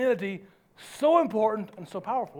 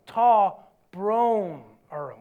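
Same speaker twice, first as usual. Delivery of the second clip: drawn-out Irish apology, low; slow lead-along; 4 semitones lower, much slower than usual. Shown as a demonstration. Preacher teaches congregation Irish sorry, slowly. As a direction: stretch each word for them to follow.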